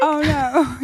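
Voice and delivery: high, loud mock Australian accent. Copying friend's Australian punchline, mock Australian accent. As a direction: laughingly echo it in Australian. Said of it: "in Australian is what the comment said".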